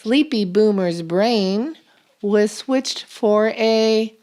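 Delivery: ordinary talk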